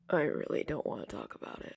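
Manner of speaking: hoarse, rasping near-whisper, a bit quick; a wrecked, scraped throat barely voicing the line. A narrator's reading. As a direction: push the words out through a raw throat. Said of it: "he said in a scraped-up voice".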